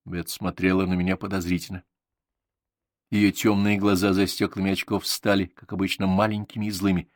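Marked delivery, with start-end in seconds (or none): none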